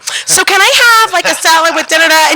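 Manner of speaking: mock picky voice. Mimicking picky friend ordering.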